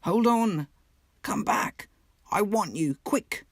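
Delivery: high, urgent, carrying call; calling after him. Acted. Mole calls to the Rat walking ahead, high and urgent. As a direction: shout ahead so he turns back.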